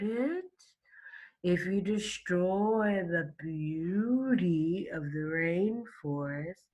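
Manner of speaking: a slow drowsy drawl, pleading gently; deep lazy sloth. Acted. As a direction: drag each word out like a sleepy sloth. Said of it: "she spoke in her deep and lazy voice".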